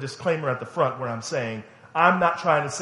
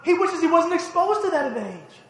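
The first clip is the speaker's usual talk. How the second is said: much higher, building; rising preaching fervor; 13 semitones higher than usual. Real, not acted. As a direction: build to a passionate plea.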